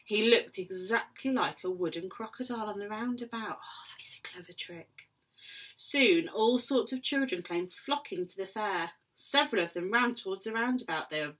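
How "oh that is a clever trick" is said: soft, breathy impressed aside; whisper. Real, not acted. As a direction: marvel under your breath.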